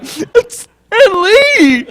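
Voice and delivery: squeaky, drawn-out fanboy wail; mock-sob the name in a very high voice; hammed-up happy crying. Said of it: "I'm crying".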